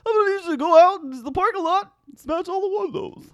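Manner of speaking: high goofy clown prank caller; Ronald McDonald. Acted. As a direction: make a crank call as a dopey clown. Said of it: "Ronald McDonald impression ever".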